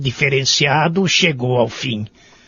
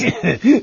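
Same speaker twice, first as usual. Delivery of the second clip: pleased little laugh; 3 semitones higher than usual. Acted. Guest delighted by favourite dish.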